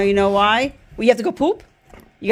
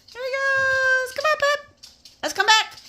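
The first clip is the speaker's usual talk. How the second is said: high calling to the dog; 13 semitones higher, faster than usual. Real, not acted. Owner calling the dog back in a high sing-song. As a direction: coax the dog home in a high voice.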